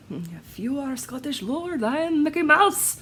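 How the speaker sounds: lightly done bad Scottish accent; a hammed-up Scottish movie line, barely there; half-hearted Scottish accent on a film quote; crappy Scottish accent. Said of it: "That's my crappy accent".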